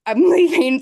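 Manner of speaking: laughing through the words. Retold or imitated. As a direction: breaks into a laugh mid-line. Husband quitting, laughing.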